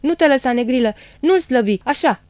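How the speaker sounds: high, loud shouts of encouragement; urgent egging-on. A narrator's reading. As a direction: cheer the dog on in the fight. Mihu urging his dog to attack. (îndemnuri strigate cu înflăcărare)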